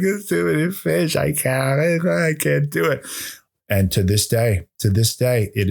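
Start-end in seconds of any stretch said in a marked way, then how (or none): 0.0-3.1 high, whimpering panic; panicked whine. Retold or imitated. Boy telling his family about the fish, high scared whimper. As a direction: squeak it out like a scared kid. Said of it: "I was like".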